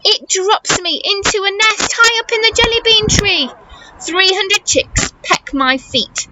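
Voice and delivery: ordinary delivery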